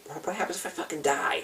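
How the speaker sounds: frantic breathy whisper of inner panic; hushed, panicky self-questioning; panicked whisper. Retold or imitated. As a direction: whisper the fear like you're spiraling. Panicked thoughts during bad trip.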